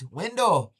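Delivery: "Window" loud and high with a falling end; Seneca-sounding window. Retold or imitated. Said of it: "And somebody says".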